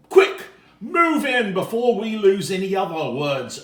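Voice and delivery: loud, high, laughing plea; excited urging. Acted. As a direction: rush them with a laugh.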